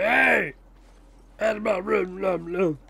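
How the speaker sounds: garbled raspy gibberish, pitched high; mock grouchy holler trailing into mumbled nonsense; put-on raspy puppet grumble; Oscar the Grouch. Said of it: "I'm making his voice sound like that".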